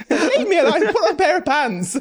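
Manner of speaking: loud, high protest breaking into laughter; mock indignant outburst. Queen snaps back at nosy questioners, laughing mock outburst. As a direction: shoo them off in a hammed-up holler, laughing.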